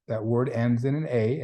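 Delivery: usual speaking voice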